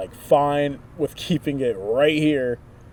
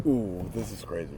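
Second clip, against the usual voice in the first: ordinary delivery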